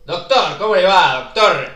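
loud, high hearty greeting; saludo efusivo. Acted. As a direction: burst in greeting the doctor at full volume. New visitor bursts in greeting. (saludo a viva voz)

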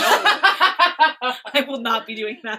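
giggling through the words; laughing while talking. Real, not acted. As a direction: laugh through a firm refusal. Mock refusal, laughing.

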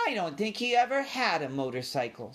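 put-on high, doubting child voice; sulky cousin. A narrator's reading. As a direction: pout it like a small mouse. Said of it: "looked disappointed and sulky".

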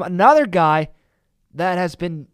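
ordinary talk

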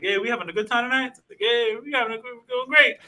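high, fast party-guy voice; hyped stranger. Retold or imitated. Imitating the bathroom dealer's hyped greeting. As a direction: play the overeager hype man.

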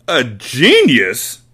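slow, scornful shouted question; shouting. Acted. Trunchbull mocking Miss Honey's claim, slow loud scorn. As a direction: spit the word back in disbelief. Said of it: "she shouted".

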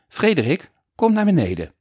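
calling out. Acted. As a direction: call him down off the railing. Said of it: "had vader Fred geroepen".

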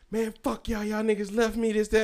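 mock indignation, pitched high; imitated angry complaint. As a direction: imitate an aggrieved friend ranting in a high voice. Voicing abandoned friend's angry answer.

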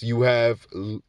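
normal talking voice

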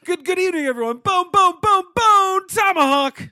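booming announcer voice, high and loud; monster truck ad. Pretend. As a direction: hype it like a truck rally promo.

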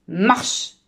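abrupt marching command; schoolmaster's command. A narrator's reading. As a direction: order the children off like a drill master. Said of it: "riep hij".

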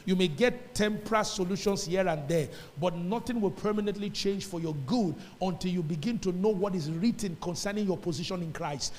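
ordinary delivery

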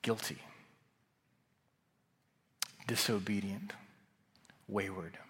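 soft, near-whispered accusations; hushed accusing voice. Acted. Preacher voicing condemnation to the congregation, hushed and whispered. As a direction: breathe each word like a voice of condemnation. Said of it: "Do you hear it?".

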